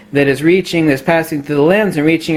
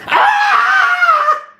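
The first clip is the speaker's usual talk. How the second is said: very high, drawn-out comic scream; mock scream. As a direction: answer with a sudden silly shriek. Mock answer to a claim.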